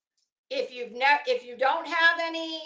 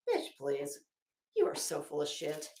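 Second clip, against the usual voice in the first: much lower, softer dismissive put-down; scornful retort; 10 semitones lower, 6 dB quieter than usual. Real, not acted. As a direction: shut her down with contempt. Retorting to the mocked Betty.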